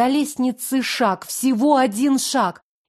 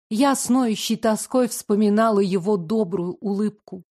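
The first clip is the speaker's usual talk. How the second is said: usual speaking voice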